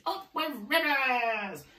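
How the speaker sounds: high, slow duckbill hoot; high hooted cheer. A narrator's reading. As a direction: hoot it joyfully, stretched out. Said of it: "And they hooted".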